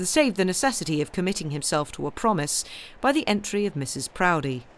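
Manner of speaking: usual speaking voice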